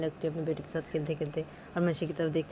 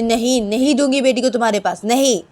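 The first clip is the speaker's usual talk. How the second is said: loud, defiant, raised-pitch no; angry refusal; 6 semitones higher, 12 dB louder than usual. Acted. Manisha refusing Nisha the baby, loudly and angrily. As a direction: refuse point-blank in a big voice.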